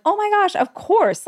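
a high, bright, mock gasp of course; high gushing reassurance. Retelling her reply to the grateful stranger, high gushing tone. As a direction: gush it like it's obvious. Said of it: "I was like".

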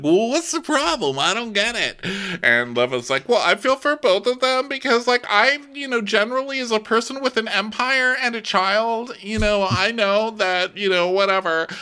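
high, mock-airy voice; lightly hammed-up high voice; mock Leva. Podcaster imitating Leva rambling, high mock-airy voice. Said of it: "Andy's just like".

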